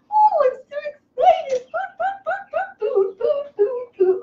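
high, squealing food chant; giddy, high-pitched repeated cheering; overexcited. Real, not acted. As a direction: get carried away with excitement over food. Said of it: "I got a little excited".

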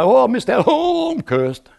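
high, mocking whine of a fearful believer; mock worried believer. Preacher mocking a fearful believer, high mock whine. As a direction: mimic the anxious rule-keeper, high and laughing.

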